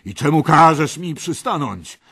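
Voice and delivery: loud, slow, indignant bellow; roaring red knight. Acted. Red knight questions the youngster, roaring slowly. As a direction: demand an answer from an insolent youth. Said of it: "ryknął czerwony rycerz".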